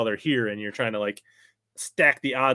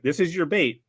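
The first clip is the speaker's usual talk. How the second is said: high, raised-voice guide correcting a client; urgent mock coaching; 7 semitones higher, 6 dB louder than usual. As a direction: call out instructions, building in pitch and volume.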